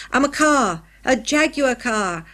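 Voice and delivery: put-on loud boyish boast called from upstairs; excited child's shout, drawn out at the end; yelled. A narrator's reading. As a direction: yell it proudly from the top of the stairs. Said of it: "yelled Tinker from the top of the stairs".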